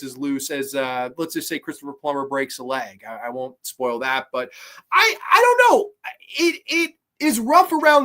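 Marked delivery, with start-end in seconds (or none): 4.9-8.0 exasperated. Real, not acted. Exasperated verdict on the film.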